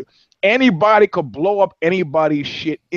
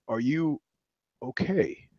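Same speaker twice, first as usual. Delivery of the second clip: softer, pointed repeat of the question; changed tone; 11 dB quieter, slower than usual. Retold or imitated. Driver asks again with a softer warning tone. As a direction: drop the voice and make it a warning. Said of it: "the tonality of the question changes slightly".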